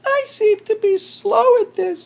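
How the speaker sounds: very high squeaky voice, a touch downcast; tiny chipmunk voice, mildly sad. A narrator's reading. Chipmunk struggling with the berries, high squeaky and a little sad. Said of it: "said Chipmunk sadly".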